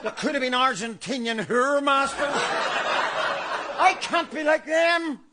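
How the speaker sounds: building mock hysteria. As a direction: work yourself into a frenzy.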